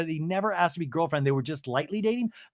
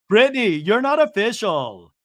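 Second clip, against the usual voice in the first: loud, higher, imitated confrontation; mock insistent shout; 5 semitones higher, 7 dB louder than usual. Lisa keeps at Brittany, loud mock insistence. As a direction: hammer it home loudly as if fed up.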